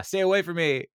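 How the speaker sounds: high, wary yelp of a jittery Leslie; high, wary stay-away cry. Retold or imitated. Leslie jittery on Zap Vigil.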